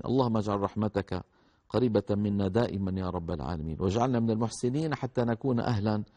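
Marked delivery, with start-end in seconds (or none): none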